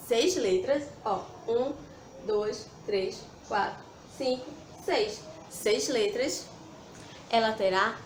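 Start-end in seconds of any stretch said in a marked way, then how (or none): none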